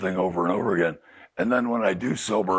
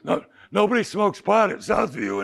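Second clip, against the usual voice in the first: voicing the son; 11 semitones higher than usual. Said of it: "He goes".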